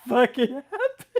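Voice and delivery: laughing, high and loud; laughing off the demand; laughing retort. Retold or imitated. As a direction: scoff it through laughter. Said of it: "We're like".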